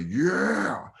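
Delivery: imitated high, drawn-out "yeah"; strange heckler yell. Comic hears the bar guy, imitated heckler yell. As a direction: let out a long high whoop. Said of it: "then I hear, like, a".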